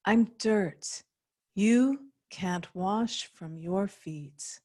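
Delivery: slow, measured poem recitation; poetry reading. Acted. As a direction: read it line by line, slowly, with deliberate pauses. Poet reads her poem aloud.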